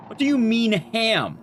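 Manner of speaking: ordinary talk, nothing marked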